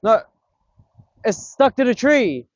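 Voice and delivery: high, drawn-out explanation; calling out. Real, not acted. Angler explaining to bystander, calling out. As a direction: call it out slowly to someone far off.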